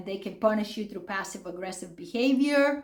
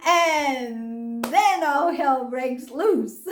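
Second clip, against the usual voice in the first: slowed, laughing through the words; amused disbelief while laughing; 4 semitones higher, 3 dB louder, much slower than usual. Real, not acted. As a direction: laugh as you tell it. Laughing at the memory.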